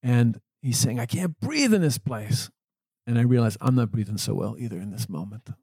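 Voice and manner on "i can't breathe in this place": higher, slowed mock yell of a grumpy old man; hammed-up angry patient, higher and slow; mock grumpy scream. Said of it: "still screaming at me at the top of his lungs".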